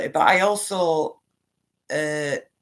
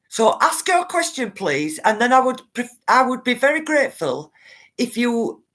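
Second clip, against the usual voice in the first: raised, loud, emphatic mock reply; firm rebuke; 5 semitones higher, 4 dB louder than usual. Host answers interrupting reporter as Kellyanne, firm rebuke. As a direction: lay down the rules to an interrupter, acted. Acts what she would have said.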